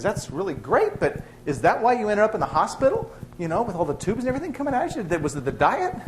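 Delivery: chatty lady. Retold or imitated. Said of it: "Lady says".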